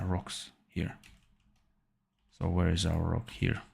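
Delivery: ordinary delivery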